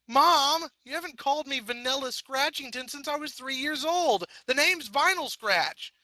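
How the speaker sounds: put-on high, insistent teen protest; indignant whine. A narrator's reading. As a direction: insist on her real name. Vinyl protests her name.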